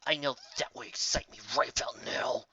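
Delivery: low, soft grumble under her breath; muttering to herself. Acted. Raindrops grumbling to herself while flying off, muttered. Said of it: "she muttered to herself".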